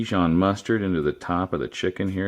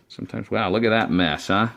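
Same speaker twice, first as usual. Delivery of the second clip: ordinary talk, nothing marked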